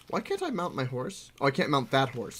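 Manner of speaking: normal talking voice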